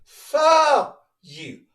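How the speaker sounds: Fuck punched high and loud on the F; stress on the F. Shown as a demonstration. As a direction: bite down on the F of Fuck and yell it. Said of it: "put emphasis on that F".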